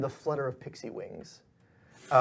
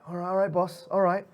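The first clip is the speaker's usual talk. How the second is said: higher-pitched, hurried-to-agree lackeys; eager-to-obey underlings, pitched up; placating henchmen; 6 semitones higher, 5 dB louder than usual. Acted. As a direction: placate the boss in a raised voice. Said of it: "everyone's like".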